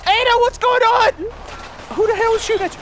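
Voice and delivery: high, loud alarm; alarmed shout. Real, not acted. Gamer shouting to his companion under fire. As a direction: call out in alarm.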